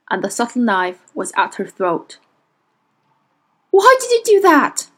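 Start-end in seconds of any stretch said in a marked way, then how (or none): 3.7-4.9 high, loud, accusing shout; angry shout. A narrator's reading. Will to the witch at knifepoint, angry shout. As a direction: shout it, pitched far above normal. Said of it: "he shouted".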